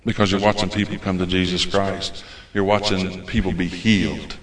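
ordinary delivery

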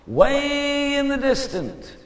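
high, drawn-out, distant-sounding; far-off effect. Retold or imitated. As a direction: stretch it out high as if from far away.

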